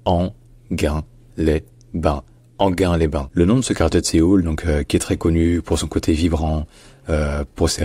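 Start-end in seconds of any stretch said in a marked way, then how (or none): 0.0-2.2 Anguin-les-Bains in four slow, separate syllables; very slow Anguin-les-Bains with pauses between syllables. Shown as a demonstration. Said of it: "Donc ça se dit". 2.6-3.3 Anguin-les-Bains said whole as "an-GAIN-lay-ban"; Anguin-les-Bains with hard g and no glide; like the word gain. Shown as a demonstration. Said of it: "Donc ça se dit".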